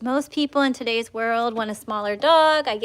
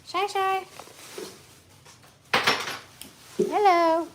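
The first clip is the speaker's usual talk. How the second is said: high cooing hello to the dog; dog greeting; 8 semitones higher, slower than usual. Real, not acted. Breeder cooing high hello to mother dog Shiloh.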